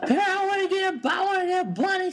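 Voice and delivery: put-on high, fast girly voice; squeaky excited girlfriend impression. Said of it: "That's my ex-girlfriend voice".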